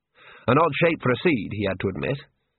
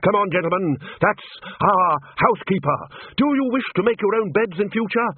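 high-pitched, rallying authority; brisk, emphatic scolding; Ridcully, brisk; 8 semitones higher than usual. A narrator's reading. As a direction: chivvy them along in a high, hearty voice. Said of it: "said Ridcully briskly".

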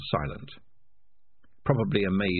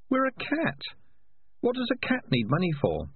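high, wry inner voice; a nagging conscience, pitched up. Acted. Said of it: "said his conscience".

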